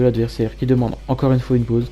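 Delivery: ordinary delivery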